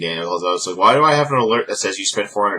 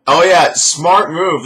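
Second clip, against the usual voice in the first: slow, high, louder sarcasm; sarcastic; 9 semitones higher, 8 dB louder, slower than usual. Real, not acted. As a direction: drip scorn on it slowly. Mocking a bad decision.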